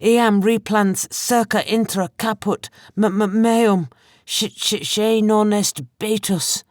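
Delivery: slow, high, stuttering foreign words; fearful stammer. A narrator's reading. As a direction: stammer in terror, foreign tongue. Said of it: "His voice was thick with fear".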